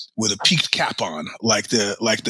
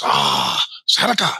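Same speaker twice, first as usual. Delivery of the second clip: put-on Chinese accent; 10 semitones lower than usual. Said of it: "her Spanish with a Chinese accent".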